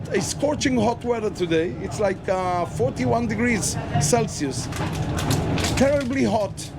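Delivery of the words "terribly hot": ordinary talk, nothing marked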